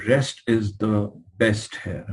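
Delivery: very slow, each word weighed; slow emphasis. Shown as a demonstration. Lecturer driving home bed rest to students, slow and weighty. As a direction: spell it out slowly to drive it home. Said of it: "I mean, I'm telling you".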